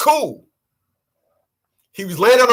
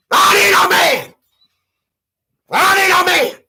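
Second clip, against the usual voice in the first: put-on wailing lonely old woman; 7 semitones higher, 4 dB louder than usual. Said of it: "old in a room all by themselves".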